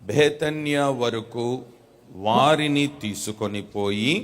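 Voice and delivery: reading a verse slowly, word by word; slow read-aloud for the church to repeat; slow dictation reading. Shown as a demonstration. Pastor reads slowly so the congregation can repeat. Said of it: "నాతో పాటు అందరూ గట్టిగా చదువుతారా?".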